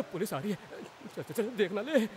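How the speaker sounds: low, softened urgent aside; hushed warning. Acted. Performer warns others that police are coming, hushed. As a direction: keep it down, someone may see.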